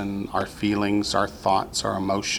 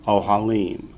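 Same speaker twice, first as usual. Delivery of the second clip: "ohalim" accented on the final "lim"; stress on last syllable. Shown as a demonstration. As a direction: carry the weight to the end of "ohalim". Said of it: "The accent shifts from the first syllable to the last".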